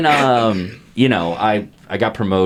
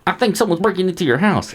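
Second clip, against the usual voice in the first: imitating the neighbor; 7 semitones higher than usual. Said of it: "kinda talks like".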